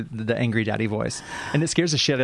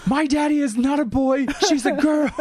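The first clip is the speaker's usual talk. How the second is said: put-on crying child; 13 semitones higher than usual. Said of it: "she started crying".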